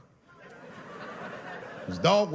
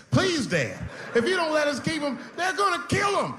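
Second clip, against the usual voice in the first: put-on very high, shrill begging kid voice; crying kids pleading. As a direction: whine like a desperate child. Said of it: "kids started crying and screaming".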